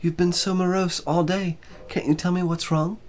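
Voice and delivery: put-on high, gentle young woman asking; concerned young woman, voiced high; Joanna's voice. A narrator's reading. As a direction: voice her worried, coaxing. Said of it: "she said".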